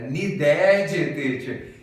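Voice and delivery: loud, exaggerated "Needed" with a long Brazilian "dédji" ending; mocking students. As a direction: tease with an overblown nee-DÉ-dji on "Needed". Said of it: "Meus alunos ficavam zoando com a minha cara". (alunos zoando, "Needed" alto e exagerado)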